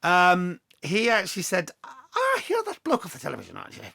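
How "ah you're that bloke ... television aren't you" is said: quick, high-starting Scottish bloke; mock Scottish local recognising a TV face; Scottish accent. Airport handler greeting the TV presenter, in a Scottish accent. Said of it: "Excuse the Scottish accent".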